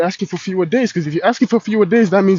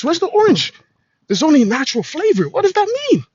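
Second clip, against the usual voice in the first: mock disbelief; 8 semitones higher than usual. Ranting at a juice label.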